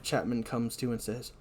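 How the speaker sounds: normal talking voice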